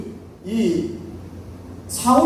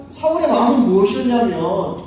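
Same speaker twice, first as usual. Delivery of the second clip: usual speaking voice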